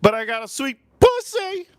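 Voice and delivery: very high put-on squeal; mock date voice. Imagined vegan date answering the man, high comic squeal. Acts out the date's reply.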